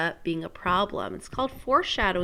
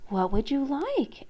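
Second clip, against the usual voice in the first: surprised princess; 5 semitones higher, 4 dB quieter than usual. Acted. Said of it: "asked the princess in surprise".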